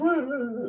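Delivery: very high fake wailing laugh; mock sobbing laugh. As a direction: wail playfully in a high, laughing boo-hoo. Mock woe at missing the feast.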